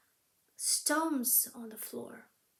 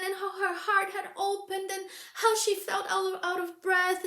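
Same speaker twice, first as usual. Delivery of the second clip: higher, faster breathless telling; breathless rush; 5 semitones higher, 5 dB louder than usual. A narrator's reading. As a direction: tumble out the story. Retelling story in rush.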